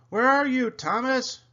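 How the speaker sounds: high, loud searching call; Stanley, whistling. A narrator's reading. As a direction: call out for a lost friend. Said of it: "he whistled and whistled".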